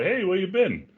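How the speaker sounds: lightly voiced cheery colleague saying hello; friendly colleague. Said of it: "His colleague walks up and is like".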